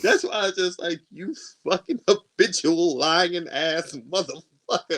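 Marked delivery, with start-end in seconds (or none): 1.1-5.0 slow, raised-pitch mock outrage; angry cussing. Cussing out his lying ex, slow exasperation. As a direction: draw the insult out word by word.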